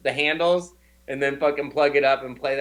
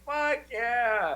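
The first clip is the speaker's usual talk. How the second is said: higher cocky yes; mock proud American; 8 semitones higher, 4 dB quieter than usual. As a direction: answer like a proud brash American.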